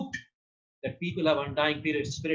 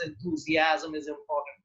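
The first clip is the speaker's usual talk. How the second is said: normal talking voice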